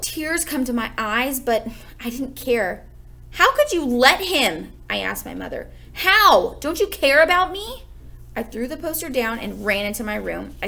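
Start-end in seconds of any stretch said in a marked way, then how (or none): 3.4-4.7 slow, louder and higher, hurt and accusing; hurt accusation. A narrator's reading. Peter blaming his mother, slow, loud, hurt. 5.9-7.8 much higher and louder, near tears; hurt outburst. A narrator's reading. Peter crying out at his mother, high and loud.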